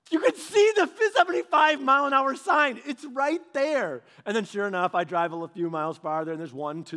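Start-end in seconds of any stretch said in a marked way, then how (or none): 0.1-4.0 louder and higher, incredulous; exasperated outburst. Retold or imitated. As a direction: blurt it out in disbelief at the trap. Exasperated at the trap.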